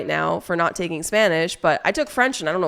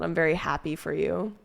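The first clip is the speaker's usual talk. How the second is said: ordinary talk, nothing marked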